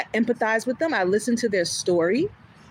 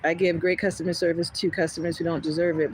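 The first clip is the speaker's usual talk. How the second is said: ordinary talk, nothing marked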